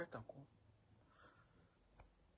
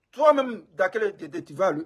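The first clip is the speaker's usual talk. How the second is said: loud call-out; 3 semitones higher, 11 dB louder than usual. Real, not acted. Calling out Dakele Guédé.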